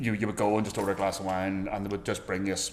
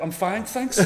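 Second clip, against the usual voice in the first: laughing brush-off; 11 semitones higher, 5 dB louder, much slower than usual. Retold or imitated. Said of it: "it's like".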